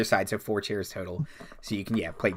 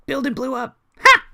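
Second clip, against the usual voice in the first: dim gnoll voice; 13 semitones higher, 7 dB louder than usual. Acted. Gnoll answers NPC.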